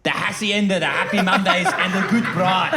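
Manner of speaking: put-on Mancunian swagger; hammed-up Manchester accent. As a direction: do a laughing Manchester lad. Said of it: "what we love in Manchester".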